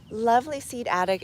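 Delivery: ordinary talk, nothing marked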